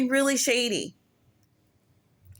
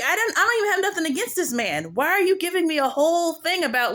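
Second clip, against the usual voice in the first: pitched-up disbelieving complaint; exasperated high protest; 6 semitones higher than usual. Retold or imitated. To the movie, an exasperated high protest. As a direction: whine it like you can't believe this. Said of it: "I'm like".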